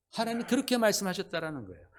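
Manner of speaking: ordinary delivery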